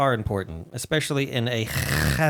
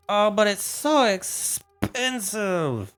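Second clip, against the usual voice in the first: slow, higher drawn-out whine; mock whine; 7 semitones higher, much slower than usual. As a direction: stretch the complaint out. Whining over price.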